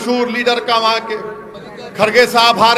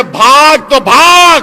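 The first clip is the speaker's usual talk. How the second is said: slow defiant shout; 5 dB louder, slower than usual. Real, not acted. Defiant rally line.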